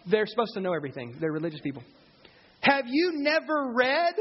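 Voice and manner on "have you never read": mocking Jesus. Jesus mocking the religious leaders.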